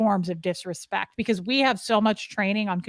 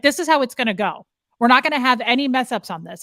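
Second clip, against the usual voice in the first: louder, commanding; Scientology command tone; 4 semitones higher, 6 dB louder than usual. Retold or imitated. Speaker lecturing sister, mock commanding voice. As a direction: lay down the rules. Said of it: "That's how they talk".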